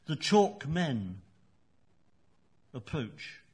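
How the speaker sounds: slow, measured recital with long pauses between phrases; poem reading. Acted. Poet reads his own poem aloud in the interview, slow and measured. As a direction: let each image hang in the silence.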